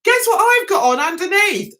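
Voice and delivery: high, eager teasing reveal; excited exclamation. Retold or imitated. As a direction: burst out, building up a surprise. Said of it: "I said, just like that".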